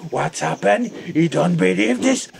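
ordinary talk, nothing marked

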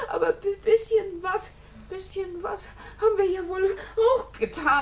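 crying, stammering protest; Dolly defending herself in tears; tearful defence. Acted. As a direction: protest through sobs.

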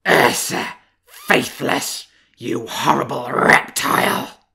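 loud, slow snarling accusation; furious growl. Acted. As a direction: spit it at the traitor. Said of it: "he growled at her".

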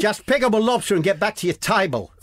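high-pitched put-on Australian accent; badly aimed accent that lands Australian; Aussie accent. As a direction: give the order in a hammed-up Aussie voice. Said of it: "No, that's Aussie".

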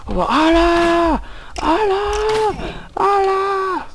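high drawn-out call, slow; calling from far away. Pretend. Calling out in the cave.

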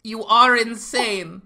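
slow, high, hammed-up Spanish accent; tender mock Spanish serenade. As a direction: woo him in a fake Spanish accent. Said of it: "why am I doing an accent?".

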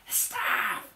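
forceful stage whisper; hissed whisper. Acted. Harold hinting at Cleopatra's staff, loud hissed whisper. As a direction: hiss it urgently. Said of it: "he hissed".

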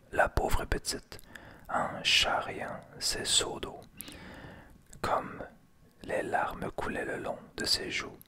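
plain reading voice

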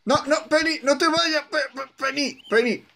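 high pleading call after Penny. Pretend. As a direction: beg Penny to stay, high. Calling after leaving character.

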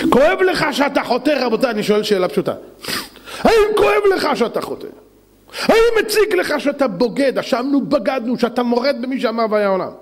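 high-pitched, urgent challenge; fervent, reproachful sermon question; impassioned preaching. Real, not acted. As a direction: confront the congregation with a pleading cry. Preacher confronts the congregation.

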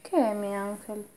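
soft, slow and gentle; tender. Real, not acted. Mother calming daughter.

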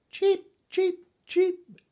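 very high, loud little cheeps; tiny chick calls; newborn duckling cheeping. A narrator's reading. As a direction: cheep like a hatchling, squeakily high. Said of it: "said the young one".

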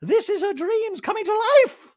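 very high, loud gushing voice. Retold or imitated. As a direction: gush over her friend in a squeaky put-on voice. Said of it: "[name] in a talking head goes".

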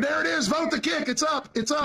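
high-pitched hurrying call; eager high-pitched prodding; excited urging. Real, not acted. Urging others to hurry the vote.

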